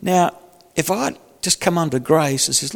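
ordinary talk, nothing marked

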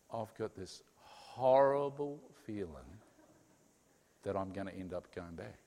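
low, soft confession of dread; almost under the breath, fearful; hushed dread. Retold or imitated. A wife confessing to her mother, low and soft. Miriam quoted to mum.

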